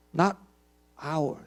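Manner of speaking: ordinary talk, nothing marked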